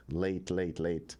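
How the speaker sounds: ordinary talk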